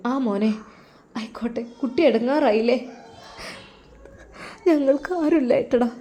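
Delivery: tearful mother. Acted. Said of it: "ഉമ്മ, നിങ്ങൾ കരയല്ലേ".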